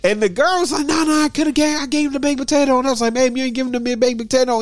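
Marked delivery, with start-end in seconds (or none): 0.9-2.8 high, hurried mock protest. Cashier insisting the food was handed over, protesting. 3.1-4.6 arguing back. Retold or imitated. Customer arguing back at cashier.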